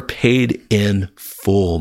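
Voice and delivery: very slow, stretched out; slow emphasis. Real, not acted. Preacher closing on paid in full, slow and emphatic. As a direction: spell it out slowly.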